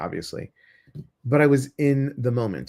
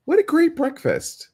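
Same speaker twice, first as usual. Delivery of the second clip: much higher, sing-song delight over breakfast; delighted exclamation. Real, not acted. Proud of her homemade breakfast, much higher delighted cry. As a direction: beam about the breakfast you just made.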